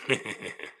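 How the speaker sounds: soft chuckle to oneself. Real, not acted. Laughs at his own jibe.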